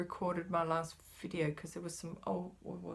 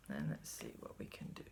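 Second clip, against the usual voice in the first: much lower, under the breath; whispered aside; 10 dB quieter than usual. Real, not acted. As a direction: murmur while working. Whispered aside, folding fabric.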